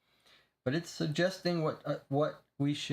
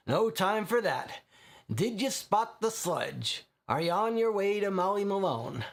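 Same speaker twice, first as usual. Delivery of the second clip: voicing the investigator; 6 semitones higher than usual. Player character voiced in scene.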